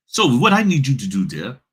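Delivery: a quick, lightly put-on British accent. Notary mocking a signing company in a British accent. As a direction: start the demand in a half-hearted British accent. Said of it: "now I'm gonna do my British accent".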